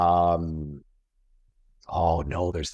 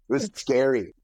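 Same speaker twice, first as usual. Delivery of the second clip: ordinary delivery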